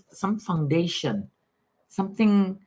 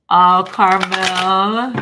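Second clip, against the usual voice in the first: moved; 3 semitones higher, 9 dB louder, much slower than usual. Real, not acted. Moved by viewer's story of mom.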